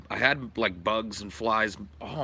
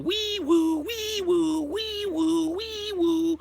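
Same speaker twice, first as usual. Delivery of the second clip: high mock siren wee woo; siren noise; slower than usual. Streamer calling the PC police with a mouth siren.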